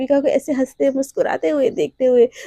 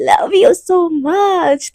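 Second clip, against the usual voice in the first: drawn-out love confession; 3 semitones higher, 5 dB louder, much slower than usual. Acted. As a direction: stretch every word out slowly, dreamily. (धीरे-धीरे खींचकर प्यार का इज़हार)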